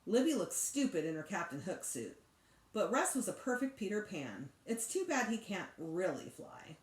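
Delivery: ordinary delivery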